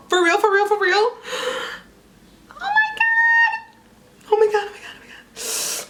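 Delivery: very high squeals into breathless whisper; overwhelmed with joy; ecstatic. Real, not acted. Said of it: "I'm trying to bring it back".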